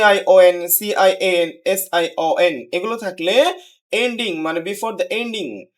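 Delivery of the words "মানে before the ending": ordinary talk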